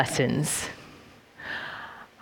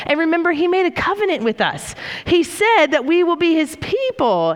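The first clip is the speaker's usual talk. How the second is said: higher, bright messenger voice calling out; eager prophet; 6 semitones higher than usual. Acted. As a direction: announce God's message to the king. Prophet voicing message to king.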